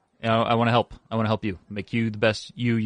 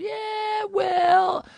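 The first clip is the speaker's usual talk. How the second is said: high mocking echo.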